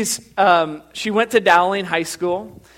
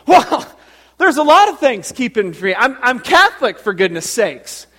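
Teresa impression; 7 semitones higher, 6 dB louder than usual. Parishioner explaining what's keeping her, loud high impression. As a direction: protest loudly in a put-on parishioner voice. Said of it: "That's exactly how she talks".

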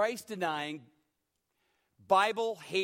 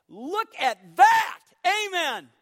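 "Look at that" slow, high and shouted; a preacher's triumphant shouted climax on "Look at that"; shouted proclamation; 8 semitones higher, 12 dB louder, much slower than usual. Shown as a demonstration. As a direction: bellow "Look at that" to the whole church. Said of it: "look all around this church and say".

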